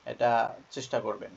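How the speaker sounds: normal talking voice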